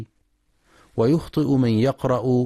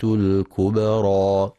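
slow, drawn-out tajweed chanting; measured melodic Quran recitation; 3 semitones higher, 3 dB louder, slower than usual. Shown as a demonstration. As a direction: recite with full ghunna and long vowels. Recited verse.

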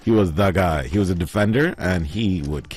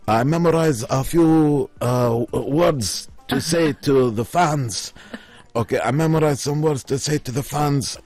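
broken-English fighter voice; 8 semitones higher than usual. Retold or imitated. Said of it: "And then he's like".

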